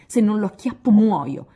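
loud, high furious yell; ready to storm out after him; girl vowing revenge, shouting. A narrator's reading. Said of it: "gridò Joe".

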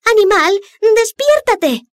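shrill, loud wake-up yell; shouting. Acted. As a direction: scream at the sleeping giant. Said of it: "le gritaba el pececillo".

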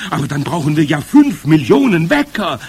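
high, loud crowd outcry; dismayed cry. A narrator's reading. Witch audience objecting to the plan, crying out. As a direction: cry out in protest. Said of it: "schrien die Zuhörerinnen".

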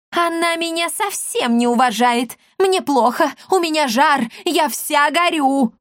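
high-pitched melodramatic wail; hysterical old woman. Acted. Old woman tells her son she is burning, hysterical. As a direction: complain in a high, overwrought voice.

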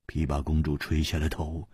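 plain reading voice

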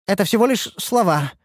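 high, testy dismissal; rising irritation. A narrator's reading. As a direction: brush it off with growing annoyance. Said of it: "начала раздражаться Ульданте".

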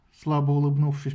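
usual speaking voice

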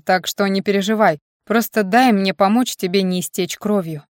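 usual speaking voice

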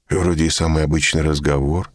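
ordinary delivery, nothing marked

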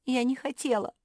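high, loud wail; hammed-up weeping protest; acted sobbing. A narrator's reading. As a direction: wail your excuse like a distraught child. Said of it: "зарыдала мопсишка".

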